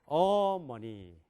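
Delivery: 어머니 slow and loud, first syllable drawn out; first-syllable stress. Shown as a demonstration. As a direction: stretch the 어 of 어머니. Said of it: "세 가지 말밖에 없어요". (첫 음절 강세)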